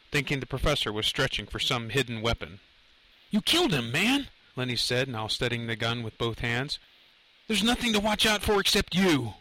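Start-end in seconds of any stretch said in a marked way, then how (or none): 3.3-4.3 high-pitched, rattled accusation; agitated accusation. A narrator's reading. Lenny to the professor over the gun, high agitated accusation. 7.5-9.3 high, tense retort; agitated threat. A narrator's reading. Lenny at gunpoint with the professor, high tense threat.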